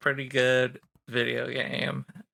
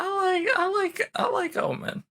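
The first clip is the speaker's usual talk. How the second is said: excited. Real, not acted. Enthusiastic squeal.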